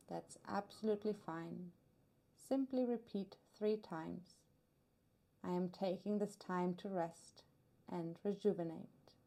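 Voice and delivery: normal talking voice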